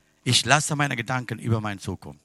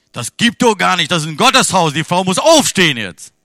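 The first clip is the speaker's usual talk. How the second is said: louder, higher protest that the woman must stand up; indignant pastor; 5 semitones higher, 9 dB louder than usual. Retold or imitated. Pastor tells the congregation the woman must stand, indignant louder.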